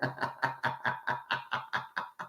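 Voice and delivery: quiet breathy chuckle trailing off; laugh. Real, not acted. Laughing at own hidden hands.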